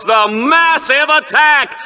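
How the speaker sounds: slow, drawn-out hype on massive; dramatic announcer. Acted. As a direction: stretch massive like a TV pitchman. Announcer hyping a weapon.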